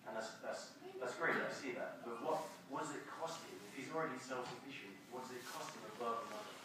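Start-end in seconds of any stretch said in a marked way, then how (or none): none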